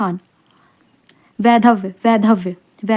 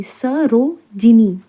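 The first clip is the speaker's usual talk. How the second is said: Sarojini very slowly, split as स, रो, जिनी; syllable by syllable; 4 dB louder, much slower than usual. Shown as a demonstration. Teacher dictating a spelling word, slow syllable by syllable. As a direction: stretch Sarojini into separate pieces.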